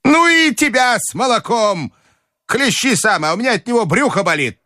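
put-on high, cross old lady; high-pitched peevish dismissal; irritated grandmother. A narrator's reading. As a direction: snap back in a high voice. Grandmother snaps at the company.

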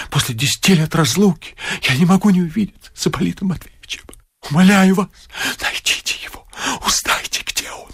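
the fortune-teller begging the locksmith in a whisper; pleading whisper. Acted. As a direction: whisper an urgent plea. Fortune-teller begs secretly.

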